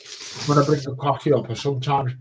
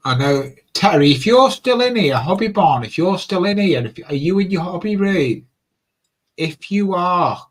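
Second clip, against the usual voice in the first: raised voice calling to another room; 5 semitones higher, 8 dB louder than usual. Real, not acted. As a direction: call out loud and high to someone away.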